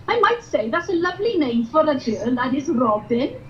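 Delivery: put-on sing-song Welsh valleys accent; chirpy Welsh valleys girl. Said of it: "I'm from the valleys".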